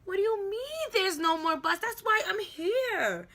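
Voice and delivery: high, loud protest, dragged out at the end; exasperated, high-pitched complaint; indignant outburst. Retold or imitated. Retold outburst at bus worker.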